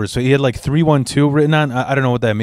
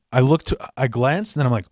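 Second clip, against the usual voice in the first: ordinary talk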